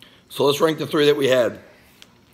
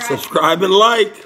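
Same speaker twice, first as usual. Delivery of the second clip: loud outro call; 6 semitones higher, 6 dB louder than usual. Acted.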